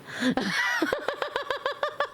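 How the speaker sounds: a squealing burst of laughter; high squealing laugh. Real, not acted. Laughs at her own remark.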